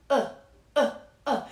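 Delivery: hard glottal catch on each uh; glottal start. Shown as a demonstration. Vocal coach demonstrating a glottal start. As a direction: snap the cords shut on uh. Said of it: "what's called a glottal start".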